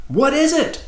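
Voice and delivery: high-pitched shout; impatient high yell in chorus. A narrator's reading. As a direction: call out the question, pitch way up. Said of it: "shouted Houston and".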